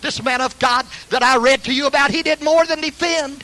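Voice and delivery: high, ringing pulpit delivery; fervent preaching. Real, not acted. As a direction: preach it with rising pitch. Preaching at raised pitch.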